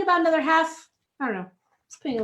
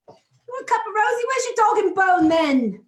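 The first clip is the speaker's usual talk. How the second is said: Cockney accent; 6 semitones higher than usual. Shown as a demonstration. Cook carrying on the requested impression, high mock Cockney accent. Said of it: "do your best Cockney accent".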